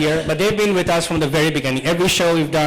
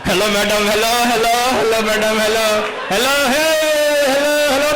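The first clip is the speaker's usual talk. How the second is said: put-on drunk passenger; 5 semitones higher, faster than usual. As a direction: ham up a tipsy guy pressing the call button. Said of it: "the Bangladeshi guy's already drunk".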